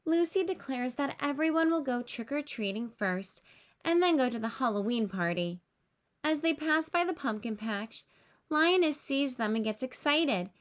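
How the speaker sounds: plain reading voice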